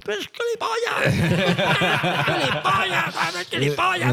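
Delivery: very high, strained mock yell; hammed-up faraway shout; distant yelling. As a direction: imitate a bandmate yelling insults from far off. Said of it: "escucho al otro Nacho, batería, a tomar por culo".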